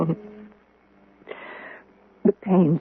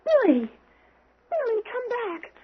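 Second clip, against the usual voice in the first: high, anxious call after someone walking out; calling after him; 4 dB quieter, slower than usual. Acted. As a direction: call him back before he's gone. Calling after Billy leaving table.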